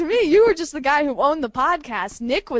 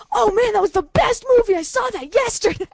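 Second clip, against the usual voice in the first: much higher and louder, thrilled; gushing; 10 semitones higher, 7 dB louder than usual. Retold or imitated. A friend gushing over a movie he already saw, loud and high. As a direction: burst out with delight.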